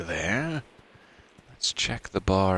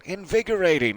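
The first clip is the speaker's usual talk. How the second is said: mild yell; 9 semitones higher, faster than usual. Acted. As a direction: let the call drop away at the end. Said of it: "Louise yelling".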